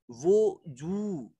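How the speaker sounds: वो जू with a short break between वो and जू, slow; correct pronunciation. Shown as a demonstration. Teacher shows students वो जू split in two, slow. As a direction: say वो जू in two parts, never as वाजू. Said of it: "इसको वाजू नहीं पढ़ेंगे, वो जू पढ़ेंगे".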